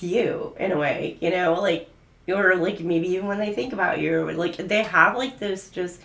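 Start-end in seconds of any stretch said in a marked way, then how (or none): none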